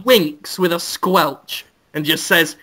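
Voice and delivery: normal talking voice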